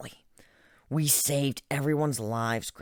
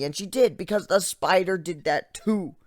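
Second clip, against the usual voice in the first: usual speaking voice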